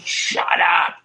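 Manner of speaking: high, strained shout without real volume; mock scream. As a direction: imitate screaming, held back and breath-heavy. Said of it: "starts screaming".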